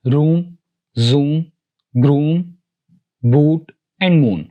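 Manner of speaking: "रूम, जूम, ग्रूम, बूट and moon" with a drawn-out "oo"; long O sound, "oo". Shown as a demonstration. Teacher giving long O examples, long "oo" in "रूम, जूम, ग्रूम, बूट and moon". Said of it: "long sound की जानिब".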